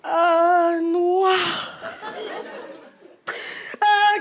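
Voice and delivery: drawn-out, horrified mock lament; wailing mother. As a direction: moan every word slowly in despair.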